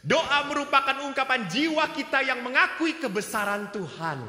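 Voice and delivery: loud, high, slow pulpit preaching; booming sermon delivery; full-force preaching. Acted. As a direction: proclaim it to the whole church.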